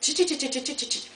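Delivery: imitated chatter.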